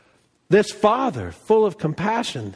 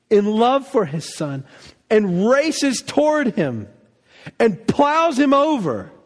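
ordinary talk

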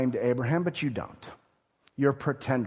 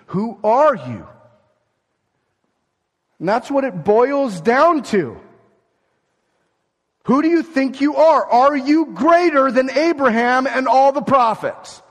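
loud, higher, slow, pounding; preaching force; 9 semitones higher, 8 dB louder than usual. Real, not acted. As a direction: drive the question home from the pulpit. Preacher building to the key question.

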